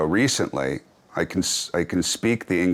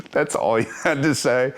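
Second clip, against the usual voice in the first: higher, incredulous question; mock disbelief; 7 semitones higher than usual. Ribbing his dad's lame TV answer in mock disbelief. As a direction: toss it back in amused disbelief.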